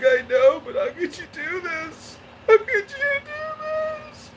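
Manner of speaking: hammed-up tearful pleading building to a cry; imitating crying grandmother. Grandmother upset over grandson's baptism, mock crying. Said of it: "she's crying and crying".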